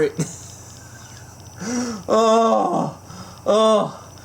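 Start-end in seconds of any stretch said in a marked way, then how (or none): none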